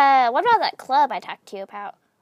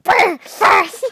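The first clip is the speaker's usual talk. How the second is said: loud, high exaggerated "burr, burst"; mocking own burst; 6 semitones higher, 10 dB louder than usual. Player mocking their own slip of the tongue, exaggerated imitation. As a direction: mimic your own botched word, blown up big. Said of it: "Why did I sound like".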